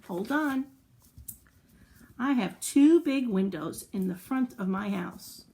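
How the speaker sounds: usual speaking voice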